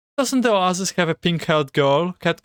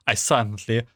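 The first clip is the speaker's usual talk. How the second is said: ordinary talk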